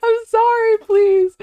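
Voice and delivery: normal talking voice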